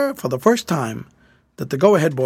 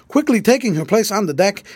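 ordinary delivery, nothing marked

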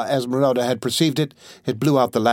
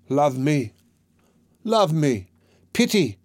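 desperate pleading cry; 3 semitones higher, slower than usual. A narrator's reading. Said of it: "cried the poor priest".

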